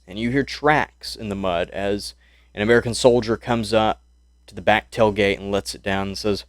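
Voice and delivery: usual speaking voice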